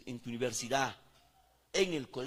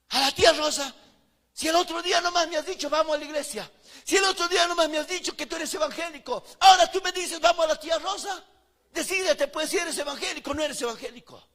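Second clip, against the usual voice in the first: higher, louder mocking rebuke; friend's retort; 10 semitones higher, 9 dB louder than usual. Friend Edgar scolding him before colleagues, higher mocking rebuke. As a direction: call him out in front of everyone. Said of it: "Sabes qué hizo mi amigo?".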